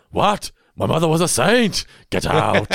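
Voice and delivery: loud, high, indignant cartoon outburst; Fry attempting Zoidberg. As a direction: yell it in a bad put-on Zoidberg voice. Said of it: "Fry attempting to do the Zoidberg voice".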